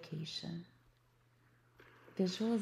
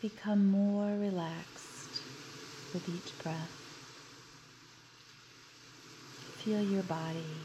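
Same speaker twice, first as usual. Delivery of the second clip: guided meditation. Acted. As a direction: guide them gently into relaxation. Leads a guided beach visualization.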